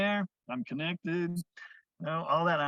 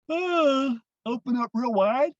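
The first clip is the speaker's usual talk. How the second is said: high, loud yawning voice; fake yawn; 8 semitones higher, 6 dB louder, slower than usual. Shown as a demonstration. Teacher yawning out loud to show jaw release. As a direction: stretch the words into a wide fake yawn. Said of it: "So yawn, fake it till you make it".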